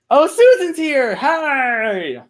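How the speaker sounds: high, loud delighted squeal of welcome; excited greeting. Real, not acted. Streamer spots a friend joining the chat, excited greeting. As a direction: squeal hello with a long drawn-out hi.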